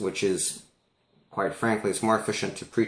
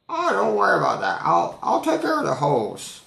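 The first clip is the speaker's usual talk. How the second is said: put-on high, louder old lady voice; old lady insisting kindly; high-pitched old woman impression; 12 semitones higher, 5 dB louder than usual.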